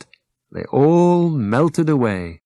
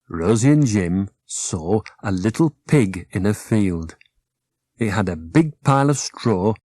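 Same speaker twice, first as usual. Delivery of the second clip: plain reading voice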